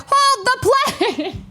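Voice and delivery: high, strained mock-panic squeal; frantic plea. Traveler phoning her friend mid-sprint, frantic squeal. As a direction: beg in a comic panic. Retold panicked phone call.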